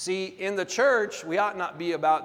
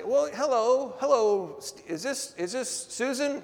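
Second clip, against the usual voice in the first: lightly voiced pushy phone caller, a bit higher; 7 semitones higher than usual. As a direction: mimic a spam caller's fake friendly opener. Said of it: "sometimes they call and they'll say".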